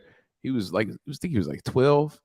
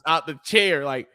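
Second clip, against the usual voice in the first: ordinary delivery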